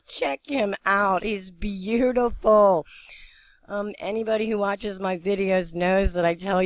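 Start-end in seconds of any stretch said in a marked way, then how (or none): 0.1-2.9 slow, drawn-out, higher-pitched admiration; lingering, awed appreciation; admiring. Real, not acted. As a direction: savour each word in delight. Admires the bird she filmed.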